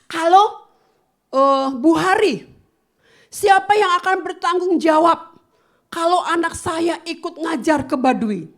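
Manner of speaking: imitating a mother complaining angrily; angry mother. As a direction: scold like an upset mother on the phone. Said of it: "marah-marah sama suami saya".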